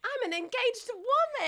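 very high, loud mock protest. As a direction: squeak it out like a scandalized lady. Mock [name] line.

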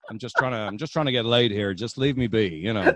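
loud, confessional mock American accent; put-on American accent. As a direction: drop the British act into an exasperated American accent. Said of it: "your accent's not real".

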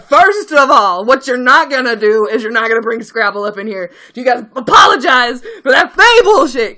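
telling family off at game night, loud; loud scolding. Retold or imitated. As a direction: lay down the law loudly.

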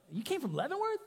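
high, teasing incredulous question; preacher imagining doubters of a hometown, mock incredulous; mock disbelief. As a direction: tease like you can't believe it. Mocking small-town disbelief.

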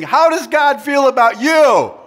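high, very loud crowd question; rousing shout. Real, not acted. Preacher demanding the crowd's yay or boo, rousing shout. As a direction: shout it out for a big response.

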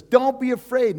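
high, loud call over the storm; calling Jesus. Acted. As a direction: call out to calm them. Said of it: "Jesus says".

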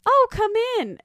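high-pitched mock greeting; welcoming hostess. Dean welcoming guests at the door, mock welcoming. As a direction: greet them high and airy, imitating.

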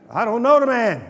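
high, loud mocking shout of Peter; mock shouted denial. Preacher mimicking Peter's denial, loud mock shout. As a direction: yell it like a cornered liar.

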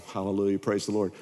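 low, soft put-on piety; a hushed, pious churchy purr; mock holy. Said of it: "I look all holy like".

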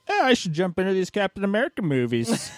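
clueless newcomer voice. Pretend. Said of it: "be like".

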